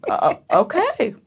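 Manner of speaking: mock-sheepish surrender; hesitant giving in. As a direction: drag out a reluctant agreement. Reluctantly giving in, acted.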